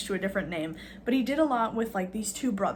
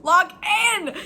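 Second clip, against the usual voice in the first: louder, higher exasperated plea; 5 semitones higher, 6 dB louder, slower than usual. Retold or imitated. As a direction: beg them to get it together. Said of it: "it's like".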